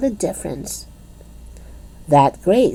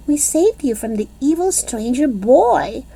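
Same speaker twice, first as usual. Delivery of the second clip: very proud; 9 semitones higher than usual. A narrator's reading. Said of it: "very proud".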